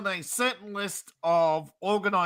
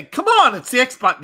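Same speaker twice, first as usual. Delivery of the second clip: high, loud mock exasperation; "come on" pitched way up, exasperated; exasperated Stewie quote; 4 semitones higher, 7 dB louder than usual. Acting out Stewie's impatient game line.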